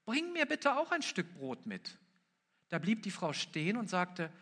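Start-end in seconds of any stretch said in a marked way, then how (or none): 0.0-2.0 calling out. A narrator's reading. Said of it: "rief er".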